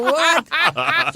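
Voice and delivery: high, laughing "What?"; laughing disbelief. Retold or imitated. Actor laughing at his own script cut. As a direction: squeak it out through a laugh. Retelling shock at being killed off.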